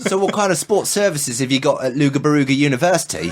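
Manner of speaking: laughing mock Australian accent, half shouted. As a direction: ham up an Australian student while laughing. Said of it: "everyone's best Australian accent".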